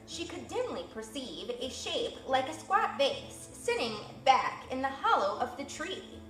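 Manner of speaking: ordinary delivery, nothing marked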